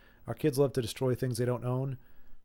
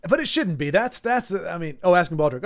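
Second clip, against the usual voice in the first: ordinary talk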